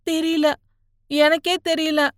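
high, small helpless voice; forlorn. A narrator's reading. Beaten youth unsure who he is, forlorn. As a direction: answer meekly, lost and pitiful. Frightened youth answers interrogation.

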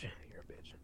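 breathy whispered insult; mock whispered taunt. Shown as a demonstration. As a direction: hiss it under your breath. Repeated as a whisper.

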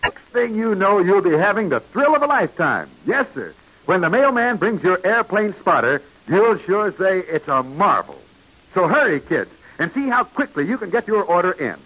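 ordinary talk

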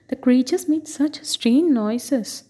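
usual speaking voice

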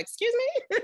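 way up high, affronted excuse me; offended excuse me. Retold or imitated. Mom taken aback by her daughter, high affronted retort. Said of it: "your first reaction is".

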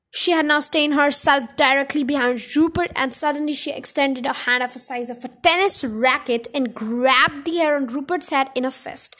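ordinary delivery

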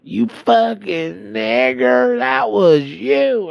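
high, slow mock yell; hammed-up enraged accusation; angry yell. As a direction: drag out an imitated furious shout. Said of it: "And he goes".

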